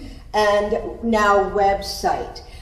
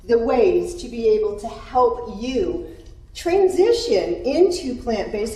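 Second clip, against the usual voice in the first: ordinary talk, nothing marked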